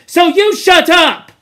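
loud, high brush-off; rude. Acted. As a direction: cut him down flatly. Jack silencing Piggy.

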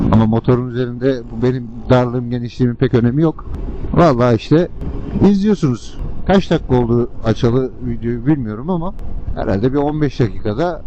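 ordinary delivery

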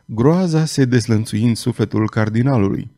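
ordinary delivery

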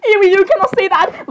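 acted "ew" outburst; mock disgusted protest. Acted shocked reply to a confession.

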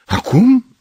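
high, rising exclamation; exclaimed question. A narrator's reading. Gavrilescu answering the girls' urging, exclaimed question. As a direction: throw it out high, as a startled question. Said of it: "exclamă Gavrilescu".

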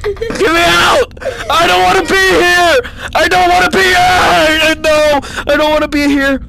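high loud crying plea; panicked crying shout; near tears. Real, not acted. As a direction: wail to be let out, really upset. Sobbing panic in game.

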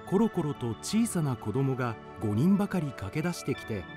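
normal talking voice

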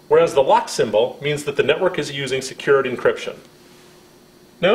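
usual speaking voice

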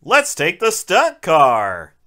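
ordinary talk, nothing marked

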